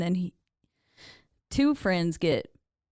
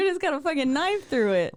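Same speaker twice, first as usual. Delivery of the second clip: high, loud imitation of a horrified boy; hammed-up panicked yelling; mock freak-out; 9 semitones higher, 5 dB louder than usual. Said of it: "her boy is like".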